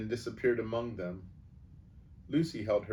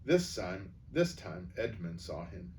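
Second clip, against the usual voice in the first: usual speaking voice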